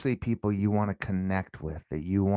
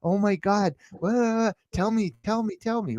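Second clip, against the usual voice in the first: high, eager, caring questions; concerned parent; 8 semitones higher than usual. Retold or imitated. Parent asking crying kid what happened, high and eager. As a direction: coax the child to open up. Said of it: "You gotta ask 'em questions".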